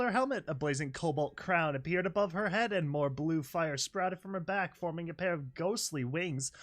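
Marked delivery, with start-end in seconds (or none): none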